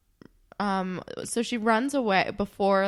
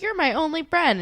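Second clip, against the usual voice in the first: high, louder mock plea; imitated pleading; 9 semitones higher, 5 dB louder than usual. Voices Holly J pleading with Anya.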